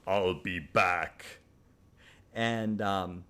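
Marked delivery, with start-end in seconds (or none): 0.1-1.4 put-on slow, heavy Austrian-accented movie line; low-key, drawn-out Austrian tough guy; Arnold Schwarzenegger impression. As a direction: drag out the catchphrase in an action-hero Austrian accent. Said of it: "famous for these lines like".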